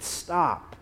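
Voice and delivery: ordinary talk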